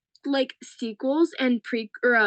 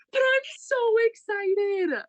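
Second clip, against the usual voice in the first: high, drawn-out excited squeal; gushing excitement; 10 semitones higher, slower than usual. Real, not acted. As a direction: stretch it out, bursting with anticipation. Gushes about an upcoming film.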